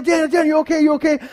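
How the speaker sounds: high, anxious repeated calling; mock alarm, pitched well up; imitated worried sister. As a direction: play a sister checking on her hurt brother. Sister checking on fallen brother.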